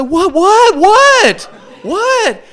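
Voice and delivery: very high, loud startled whats; shrieking in sudden panic; panicked squeak. Retold or imitated.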